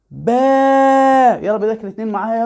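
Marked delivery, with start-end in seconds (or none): 0.1-1.4 باء with the vowel held very long and higher. Shown as a demonstration. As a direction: stretch باء out on one sustained note. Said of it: "نشد الحرف عشان كبره بقى طويل خالص". (باء بمدّ طويل جدًا)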